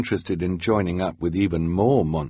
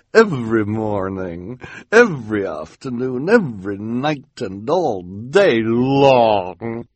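gushing villainess; 3 semitones higher, 4 dB louder, slower than usual. Acted. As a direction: swell grandly to a long final word. Esme's gushing pledge continues.

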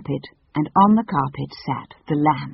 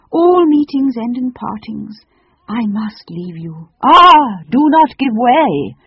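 loud, slow, high declamation; grand tragic leave-taking, swelling on the exclamation; melodramatic farewell; 8 semitones higher, 9 dB louder, slower than usual. Acted. Phoenix bidding the children not to cry, melodramatic.

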